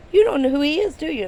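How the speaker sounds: can't believe she missed it; incredulous friend. Retold or imitated. Friend asking her in disbelief who the star is.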